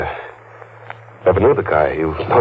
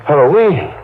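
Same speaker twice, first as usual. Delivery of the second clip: startled echo, pitch jumping up; 6 semitones higher than usual. Acted. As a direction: caught off guard by the word.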